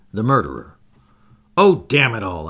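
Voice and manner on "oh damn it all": angry outcry, raised voice; crying out. A narrator's reading. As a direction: blurt it out in exasperation. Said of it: "I cried".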